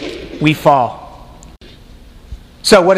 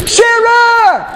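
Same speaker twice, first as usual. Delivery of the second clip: calling Sarah. Acted. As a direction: shout for Sarah, thrilled and frantic. Abraham calls to wife.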